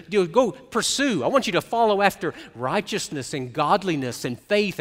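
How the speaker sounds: loud, fervent pulpit delivery; full-force preaching. Acted. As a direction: preach it hard to the congregation.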